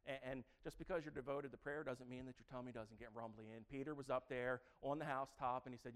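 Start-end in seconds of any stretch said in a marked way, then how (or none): none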